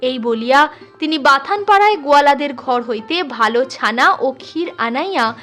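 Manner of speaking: ordinary delivery, nothing marked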